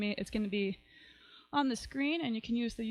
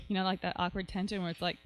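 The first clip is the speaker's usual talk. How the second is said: usual speaking voice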